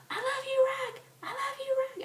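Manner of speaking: very high, repeated adoring cry; fangirl squeal. Retold or imitated. Fan repeating her love to Rock, high squeal. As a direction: squeal it over and over.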